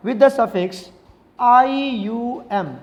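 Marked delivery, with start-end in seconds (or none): 1.4-2.7 आईयूएम letter by letter, very slowly; spelled out slowly. Shown as a demonstration. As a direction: draw out each letter, I, U, M. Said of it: "कौन सा suffix लगाएंगे". (आईयूएम एक-एक अक्षर खींचकर)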